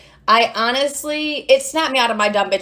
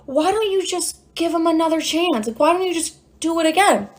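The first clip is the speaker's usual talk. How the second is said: higher, casual therapist voice; imitated therapist; 6 semitones higher than usual. Therapist talking her into another chance, higher imitated voice. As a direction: pitch it up, breezily suggesting. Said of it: "She's like".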